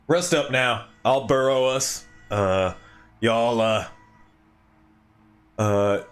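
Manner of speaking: put-on a slipped, wrong regional accent for the burrowing healer; a mistaken accent tried on the innkeeper-like healer; wrong NPC accent. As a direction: start the NPC line in the wrong accent, then catch it. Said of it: "I was doing the wrong accent".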